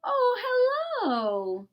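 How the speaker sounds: high, delighted "Oh" then gentle hello; softened surprise. Acted. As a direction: melt from sternness into charmed greeting. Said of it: "in quite a different voice".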